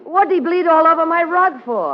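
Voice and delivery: high, outraged complaint; indignant. Acted. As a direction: huff about the rug. Indignant over ruined rug.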